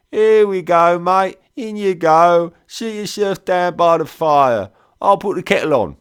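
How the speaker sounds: very high, loud London cockney; thick cockney. A narrator's reading. Trader welcomes soldier inside, loud high cockney. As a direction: usher him in as a hearty cockney host. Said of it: "in that thick London cockney".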